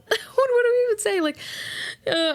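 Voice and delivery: high, loud laughing question; laughing squeal. Real, not acted. Laughing at own example.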